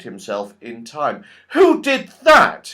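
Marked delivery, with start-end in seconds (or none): 1.5-2.7 sharp, loud, slow angry shout; angry cry. A narrator's reading. Joe shouts up the dark tree at the unseen attacker, angry. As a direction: demand to know who pushed him. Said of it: "he cried angrily".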